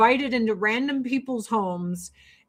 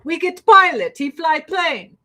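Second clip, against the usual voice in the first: louder, higher hammed-up Russian accent; 6 semitones higher, 6 dB louder than usual. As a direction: boom it out like a proud Russian mayor. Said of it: "the mayor of Sochi was like".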